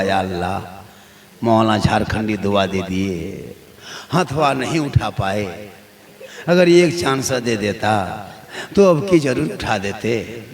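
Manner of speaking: low, slow regretful murmur; imagined inner voice. Retold or imitated. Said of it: "वो मन में सोचता होगा". (मन ही मन पछताता आदमी, धीमी आवाज़ में)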